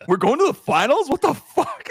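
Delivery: high, loud, incredulous outburst; reliving the shock of the win in a squeal; stunned disbelief. Retold or imitated. Fan learning his team made the finals, high incredulous squeal. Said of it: "I was like".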